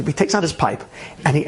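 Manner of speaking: ordinary talk, nothing marked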